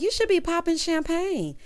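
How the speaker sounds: high, eager imitation of a girlfriend; retold excitement. Host mimicking girlfriend hyping the paycheck, excited. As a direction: mimic her celebrating, pitched well up. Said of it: "So she all excited".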